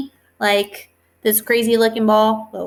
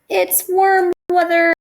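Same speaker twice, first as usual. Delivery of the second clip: higher, louder, stretched title; cheerful announcement; 8 semitones higher, 6 dB louder, slower than usual. Shown as a demonstration. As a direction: sell the title to the class. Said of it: "the worms are going back after the rain".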